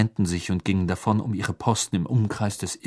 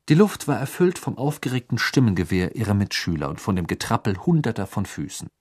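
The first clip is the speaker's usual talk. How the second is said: ordinary delivery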